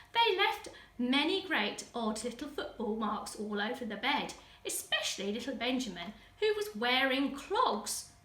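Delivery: plain reading voice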